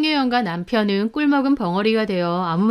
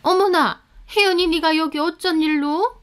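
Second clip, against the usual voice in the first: high-pitched astonished mother-in-law; surprised; 8 semitones higher than usual. A narrator's reading. Mother-in-law finds Hye-yeon at the house, surprised. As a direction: exclaim in pleasant astonishment, pitched up. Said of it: "있는 걸 보고 놀라시더라고요".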